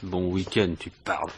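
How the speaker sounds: low, soft sarcastic grumble to himself; bitter muttering. Acted. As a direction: mutter the boss's words back with disgust. Alone after the boss leaves. (marmonnement amer et sarcastique, plus bas)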